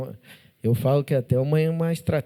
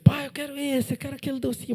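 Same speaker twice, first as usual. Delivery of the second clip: put-on much higher child's voice asking for sweets; begging child; 11 semitones higher than usual. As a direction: play a little kid pleading with dad.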